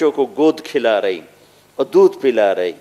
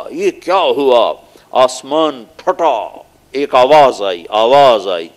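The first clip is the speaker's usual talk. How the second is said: ordinary talk, nothing marked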